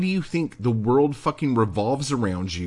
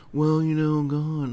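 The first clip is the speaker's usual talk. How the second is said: mocking a sighing writer, a bit higher; whiny writer mockery; 5 semitones higher than usual. Podcaster mocks complaining writers with a whiny sigh. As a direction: roll your eyes and whine it like an entitled writer. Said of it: "a lot of you are just like".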